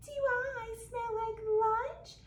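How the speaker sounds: slow, sweet and sugary; sugarcane voice. Acted. Skunk flirting with the gator in a sugary voice. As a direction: drawl it out sweet as syrup. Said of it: "in a sugarcane voice says".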